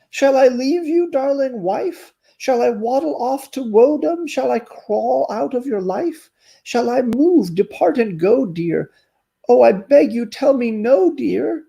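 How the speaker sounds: a heartbroken turtle begging answers; storybook turtle, hurt and pleading. Acted. Turtle begs to stay.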